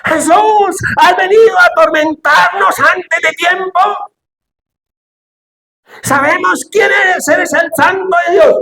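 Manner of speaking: high, slow, strained demonic shout. Acted. Possessed boy screaming at Jesus in the synagogue, high demonic shout. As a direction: cry out like a tormented demon. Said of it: "comenzó a gritar".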